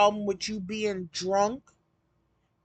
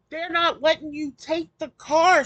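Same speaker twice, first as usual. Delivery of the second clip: normal talking voice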